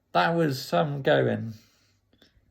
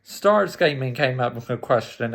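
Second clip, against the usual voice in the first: ordinary talk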